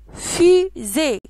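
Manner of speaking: FUSÉE in two loud beats, fu, zée; syllable by syllable. Shown as a demonstration. Teacher names the picture fusée, loudly split into syllables. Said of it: "Il y a deux syllabes".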